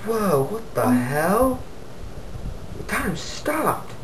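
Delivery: drawn-out, high-pitched mock shock; hushed, high storybook astonishment; acted amazement. Man finds his remote stopped time, acted amazement.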